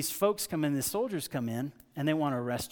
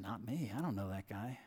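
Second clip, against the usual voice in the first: low, hushed brush-off; evasive denial; 4 semitones lower, 8 dB quieter than usual. Acted. Peter to the servant girl, quietly evasive. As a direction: deny it softly, keeping the voice down.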